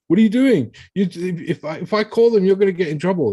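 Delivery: a father suddenly scared of the consequences; panicked dad. Retold or imitated. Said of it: "And he's like".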